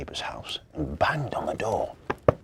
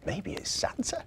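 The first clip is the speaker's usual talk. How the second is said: high, soft, breath-light guess; hushed child voice; 9 semitones higher, 7 dB quieter than usual. A narrator's reading. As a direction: half-whisper it as a little boy. Boy guesses who made the noise.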